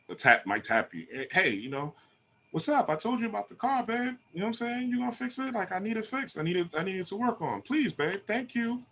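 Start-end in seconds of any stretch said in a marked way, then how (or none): 1.3-8.8 much higher, soft and coaxing; sweet wise woman. Retold or imitated. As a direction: sweet-talk him into fixing the car. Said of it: "say it nice".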